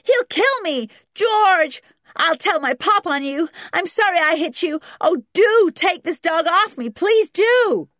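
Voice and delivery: very high, loud terrified wailing; begging. A narrator's reading. Boy pinned by dog pleading with George, panicked begging. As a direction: plead frantically, voice pitched right up. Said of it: "begged Junior".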